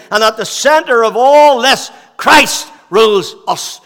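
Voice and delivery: loud, high, impassioned preaching; fervent pulpit climax; preaching at full force. Acted. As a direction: build the sermon to a ringing climax. Preaching crescendo.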